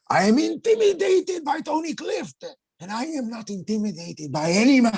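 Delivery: a fussy, rattled director squeaking out his complaint; voicing the director.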